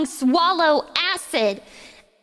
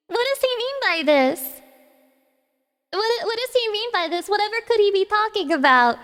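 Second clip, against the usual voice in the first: mock innocent; 5 semitones higher than usual. Feigning innocence about sus.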